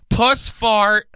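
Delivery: high, loud shouted call; mock yell. Guy yelling his bingo call, mock yell. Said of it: "Some guy yells".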